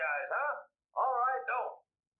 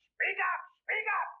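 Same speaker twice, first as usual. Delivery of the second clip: shouted demand; 9 semitones higher, slower than usual. Acted. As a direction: yell it twice to get heard. Deaf man shouting again.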